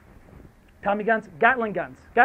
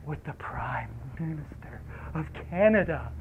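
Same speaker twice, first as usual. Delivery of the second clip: whispered, lower, like a tempting secret; dramatic whisper; 5 semitones lower, 8 dB quieter than usual. Retold or imitated. Officials tempting the strikers, a teasing whisper. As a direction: hush it as if revealing a prize. Dangling the grand offer.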